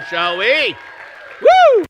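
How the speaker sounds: big whooping cheer, high and loud; hyped host calling for a welcome; rallying cheer. Real, not acted. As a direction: whip the crowd into a cheer.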